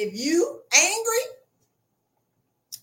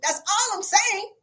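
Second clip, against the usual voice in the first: usual speaking voice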